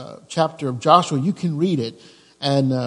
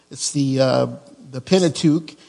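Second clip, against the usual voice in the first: usual speaking voice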